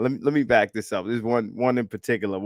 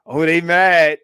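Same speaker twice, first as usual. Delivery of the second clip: louder, higher gloating call; mocking gloat; 6 semitones higher, 9 dB louder than usual. Real, not acted. Streamer gloating at angry internet commenters, loud and mocking. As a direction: rub it in, loud.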